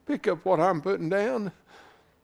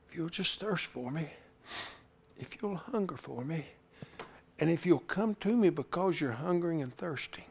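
much softer, low and intimate as God's voice; tender hush; 3 semitones lower, 7 dB quieter than usual. Acted. God promising Scott life, tender and hushed. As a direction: speak the promise gently to one man.

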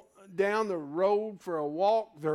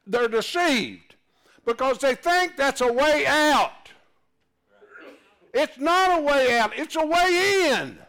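louder, higher fervent preaching; louder and higher, full-force sermon; 7 semitones higher, 8 dB louder than usual. Acted. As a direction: drive the point home to the congregation.

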